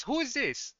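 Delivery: high-pitched, affronted question; offended boss confronting a stranger; indignant demand. Acted. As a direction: demand to know, voice leaping up. Chef demands who the stranger is.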